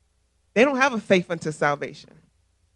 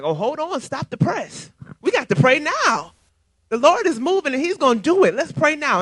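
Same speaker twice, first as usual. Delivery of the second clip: higher and louder, fired up; urgent excitement; 7 semitones higher, 5 dB louder than usual. Retold or imitated. Two friends rallying to pray for their clique friend, fired up. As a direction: stop everything and rally to pray.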